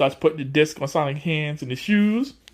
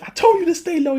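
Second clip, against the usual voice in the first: high, scolding sidekick voice; imitating Tails; 14 semitones higher, 3 dB louder than usual. Tails scolds Sonic as they're taken away, high and scolding. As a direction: scold him up high, told-you-so. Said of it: "Tails is like".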